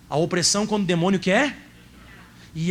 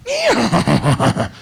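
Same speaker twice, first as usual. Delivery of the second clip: loud burst of laughter; 6 dB louder than usual. Real, not acted. Laughs mid-sentence about stress.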